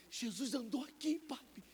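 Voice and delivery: a hint of a small son speaking; son's voice. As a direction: lightly voice a small boy. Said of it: "meu filho falou".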